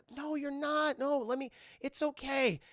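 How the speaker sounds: high-pitched imitated comforting; acted earnest boyfriend; pleading reassurance. Date reassures crying girl.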